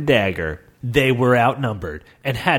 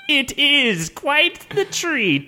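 very slow, high, laughing ghost; wicked ghost; 12 semitones higher, much slower than usual. Acted. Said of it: "laughed wickedly".

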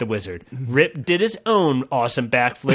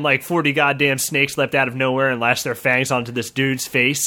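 ordinary delivery

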